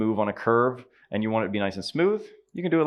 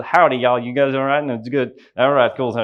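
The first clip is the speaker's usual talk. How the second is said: deep Southern accent; 6 dB louder than usual. Shown as a demonstration. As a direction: lay on an exaggerated Deep South twang. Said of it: "That's the deep South".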